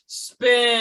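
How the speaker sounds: high, drawn-out group chant; too slow. Pretend. Leading friends in saying the wheel catchphrase together, far too slow. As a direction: stretch it out into one long loud shout. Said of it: "You say it too slow".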